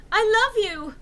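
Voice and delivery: high, loud declaration; love confession. Acted. Enderby telling Draco she loves him, high loud. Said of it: "I said".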